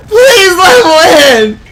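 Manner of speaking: high, loud begging cry; desperate plea. Real, not acted. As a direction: beg the game at the top of your voice. Begging during tense moment.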